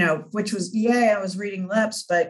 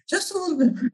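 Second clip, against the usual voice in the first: high mock-innocent squeak; sarcastic understatement; 11 semitones higher, faster than usual. To her guests, high sarcastic understatement. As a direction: chirp it up high, wryly.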